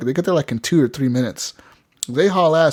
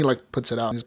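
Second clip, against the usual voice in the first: ordinary talk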